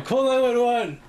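high, loud frantic call for help; hammed-up cry for help; mock panicked yell. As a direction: yell it from the floor in fake panic. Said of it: "I'd be on the floor going".

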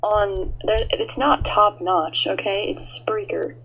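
loud rant. Real, not acted. Cohost rants that the DJ's platform is not top notch, loudly. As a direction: hammer home that it's not top notch. Ranting at the DJ.

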